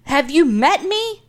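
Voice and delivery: high, slow ironic comeback; wry retort. Real, not acted. Teased as hypochondriac, high slow ironic retort. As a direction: drawl it like it's obvious.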